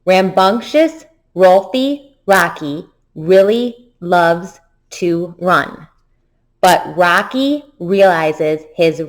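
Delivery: very slow, drawn-out twister; slow choral reading; really slowly. Shown as a demonstration. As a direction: stretch every word out for the class. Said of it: "One more time".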